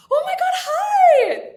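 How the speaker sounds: squealed high, loud and slow, with a laugh; high-pitched hi. Shown as a demonstration. Showing her signature greeting, a squealed high loud hi. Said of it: "a high-pitched hi".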